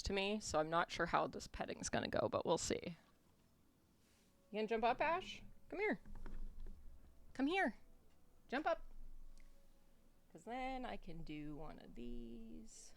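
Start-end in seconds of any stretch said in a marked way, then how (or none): 4.5-8.8 high-pitched coaxing call; coaxing the dog. Real, not acted. Coaxing the dog Ash to jump up, high pet voice. 10.3-12.9 much softer and slow; soft pet talk. Real, not acted. Leaning in to pet the dog, slow soft murmur.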